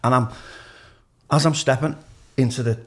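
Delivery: usual speaking voice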